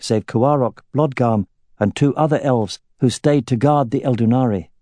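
plain reading voice

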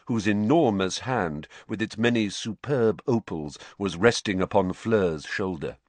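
ordinary delivery, nothing marked

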